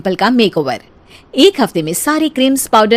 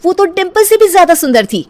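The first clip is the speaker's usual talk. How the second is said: amazed; 8 semitones higher than usual. A narrator's reading. Narrator amazed by rival bride.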